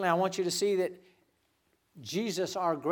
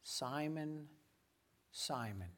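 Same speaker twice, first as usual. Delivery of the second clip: soft intimate call; 12 dB quieter, slower than usual. Acted. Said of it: "he says".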